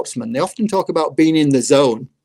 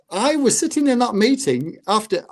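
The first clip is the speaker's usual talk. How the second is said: ordinary delivery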